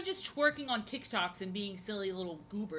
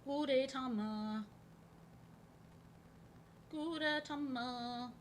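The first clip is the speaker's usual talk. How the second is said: singsong name call; 5 semitones higher, 4 dB quieter, much slower than usual. Pretend. Calling the character's name.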